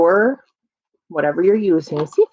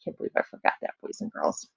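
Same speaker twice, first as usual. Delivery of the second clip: whispering; 10 dB quieter, faster than usual. Real, not acted. Whispered aside to kids.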